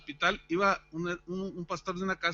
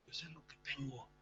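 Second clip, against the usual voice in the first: whisper; 3 semitones lower, 13 dB quieter than usual. Retold or imitated. Whispering to doctor friend in exam.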